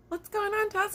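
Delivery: high worried coworker voice; coworkers asking. Retold or imitated. Coworkers running over, high concerned question. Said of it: "and they're like".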